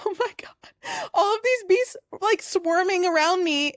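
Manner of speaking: very high, distressed squeal; mock anguished wail. Telling friend about the harmless mother bees, mock anguished wail. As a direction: wail it in hammed-up horror.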